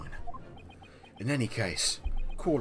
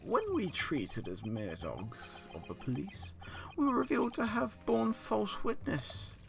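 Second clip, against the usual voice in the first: put-on theatrical old man; 10 semitones higher than usual. Voicing a dramatic old witness.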